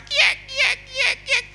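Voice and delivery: high, piercing chick cheeps; chick cheeping. Retold or imitated. Boy mimics the chick left crying in the rice field. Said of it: "Teriak-teriak".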